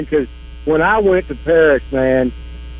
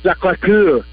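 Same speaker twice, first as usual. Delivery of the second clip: "Sacré-Cœur" with a French accent, sa-kray kur; a listener asked to hear it again, French accent; slower than usual. Shown as a demonstration. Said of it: "Say that again?".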